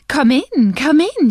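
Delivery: wife proudly greeting her husband at the door; eager welcome. Acted. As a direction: beckon him in with delight.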